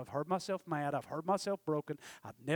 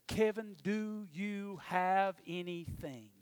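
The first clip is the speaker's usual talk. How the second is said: drawn-out, very slow question; each word slow and weighty; slow dramatic call; much slower than usual. Retold or imitated. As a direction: stretch Kevin out slowly. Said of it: "verbatim, word for word".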